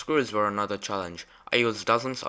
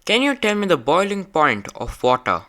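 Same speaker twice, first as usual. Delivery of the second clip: loud, clear question; 8 semitones higher, 8 dB louder than usual. Real, not acted. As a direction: speak up high and clear for the robot. Asking the robot head a question.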